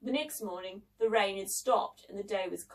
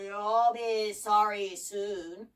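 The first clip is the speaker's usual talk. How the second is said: very slow, drawn-out menace; scratchy monster's slow warning; snarling gramophone giant; much slower than usual. A narrator's reading. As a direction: snarl the threat at half speed. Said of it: "snarled the gramophone".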